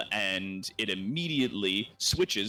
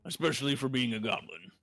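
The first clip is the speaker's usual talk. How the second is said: put-on voice of an approving teacher; impressed old mentor. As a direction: give grudging-sounding praise as an old mentor. Game master plays impressed mentor.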